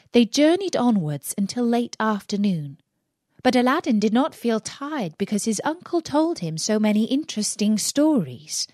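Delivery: ordinary delivery, nothing marked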